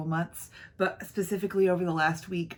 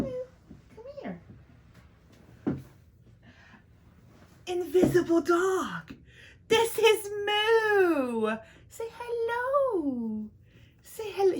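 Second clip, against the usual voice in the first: much higher, sing-song pet voice; 13 semitones higher than usual. Real, not acted. Greeting her dog Moo.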